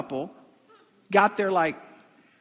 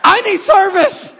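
much higher, louder laughing shout; mock demand; 12 semitones higher, 13 dB louder, slower than usual. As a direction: yell for the waiter as a joke.

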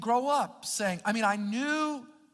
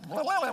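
comic garbled blah-blah noise; imitated tongue-tied babble; 6 semitones higher, 4 dB louder than usual. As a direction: let the tongue flop into mock babble. Imitating awkward first saying of love.

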